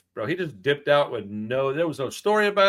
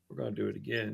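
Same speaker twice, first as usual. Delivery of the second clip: quiet mutter; 7 semitones lower, 14 dB quieter, faster than usual. Retold or imitated. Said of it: "I felt my mouth going".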